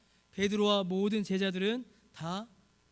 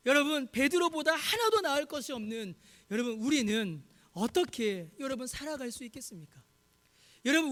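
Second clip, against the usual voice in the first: rising, louder sermon climax, pressing the congregation; impassioned preaching; 5 semitones higher, 4 dB louder than usual. Real, not acted. Pastor pleading with the congregation, building to full force. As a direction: preach it louder and higher toward the end.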